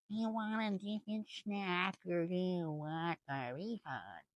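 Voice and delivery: high, soft, put-on attendant voice; mock flight attendant. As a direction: imitate an over-sweet attendant, softly. Flight attendant quoted in story.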